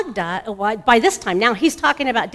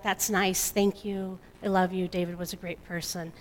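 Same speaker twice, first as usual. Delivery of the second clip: soft, dismissive thank-you, much softer; polite brush-off; 8 dB quieter than usual. Retold or imitated. Brushing off well-meaning friends, quiet and dismissive. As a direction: murmur the niceties without meaning them.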